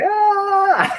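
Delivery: high yelp of pain; mock scream. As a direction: reenact the kid's yell. Childhood injury scream re-enacted.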